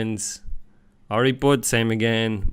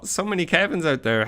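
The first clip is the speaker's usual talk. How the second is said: ordinary talk, nothing marked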